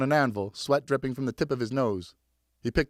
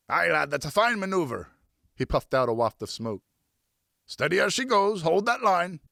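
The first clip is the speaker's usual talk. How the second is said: riverman's orders; 6 semitones higher than usual. A narrator's reading. Francois ordering the boy to tack, firm and salty. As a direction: bark the order across the boat.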